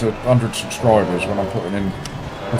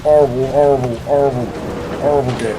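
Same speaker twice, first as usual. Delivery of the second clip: mock angry telling-off; 5 semitones higher than usual. Real, not acted. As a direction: growl out the insult, half in jest. Cursing a rival car in game.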